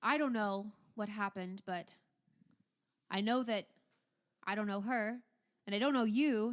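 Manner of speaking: forceful, stern ducal voice; exasperated Duke. Acted. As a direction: lay it out phrase by phrase, exasperated. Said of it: "He sighs and he says".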